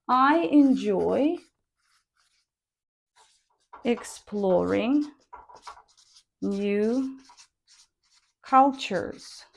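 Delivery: I enjoy exploring new cultures broken into slow single words; slow word-by-word drill. Shown as a demonstration. Teacher dictating the sentence word by word, slowly. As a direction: drill each word with long gaps. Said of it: "This is your statement".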